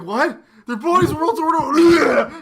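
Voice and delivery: mock disbelief. Incredulous quote.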